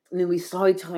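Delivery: usual speaking voice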